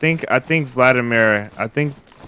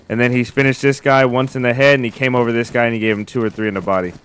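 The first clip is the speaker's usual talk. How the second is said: usual speaking voice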